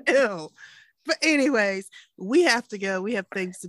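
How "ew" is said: disgusted. Real, not acted. Disgust repeated.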